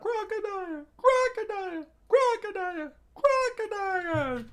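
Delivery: very high falsetto crowd chant, loud; crowd screaming. Acted. Crowd screams after the departing Crocodile, screaming. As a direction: scream it out like a shrill cheering crowd. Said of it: "As the crowd screamed out".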